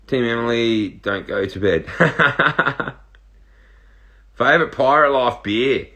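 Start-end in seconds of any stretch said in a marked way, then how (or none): 1.9-2.9 real amused chuckle; laugh. Real, not acted. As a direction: laugh in a quick run of ha-has. Laughs at a viewer's comment.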